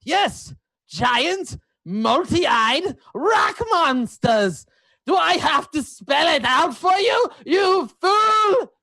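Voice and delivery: loudly enunciated, indignant outburst; theatrical, exasperated insistence. Acted. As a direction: spell it out as if to a fool. Exasperated player character insists.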